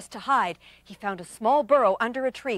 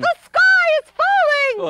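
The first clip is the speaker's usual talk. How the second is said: high and slow, a drawn-out chipmunk cry; yelling chipmunk; 8 dB louder, slower than usual. Acted. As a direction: wail the warning through the woods.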